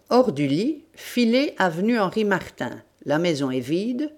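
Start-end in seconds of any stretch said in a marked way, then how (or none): none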